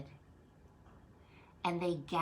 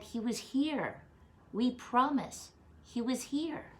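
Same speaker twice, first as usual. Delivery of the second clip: excited insisting; 7 semitones higher, 7 dB louder than usual. Acted. Disciples insisting to doubting Thomas.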